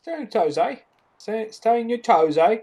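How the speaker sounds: high hammed-up Cockney echo; playful fake Cockney; mock Cockney. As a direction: repeat it like a cheeky Cockney kid. Mimicking the character's line.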